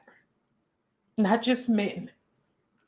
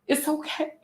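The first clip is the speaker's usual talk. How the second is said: very soft, much quieter, tearful; fading tearful okay; 7 semitones higher, 8 dB quieter than usual. Real, not acted. Vlogger reassuring her sisters, fading into tears. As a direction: let it almost vanish. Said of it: "I said I wasn't gonna get on her crying".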